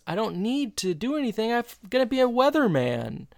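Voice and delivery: put-on high, jokey teen voice; hammed-up teen boy; high mock Eric. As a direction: play the smug son lightly. Voicing Eric's cocky reply.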